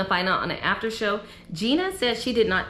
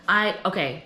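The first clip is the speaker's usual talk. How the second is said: ordinary talk, nothing marked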